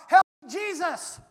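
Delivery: loud, slow desperate cry. Retold or imitated. Preacher recalling being stabbed, crying out loud. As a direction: cry out to Jesus for help. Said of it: "If I hadn't cried out".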